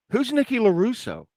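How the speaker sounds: high, insistent mock question; retold bafflement. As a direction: act out demanding who that is. Retold baffled back-and-forth argument.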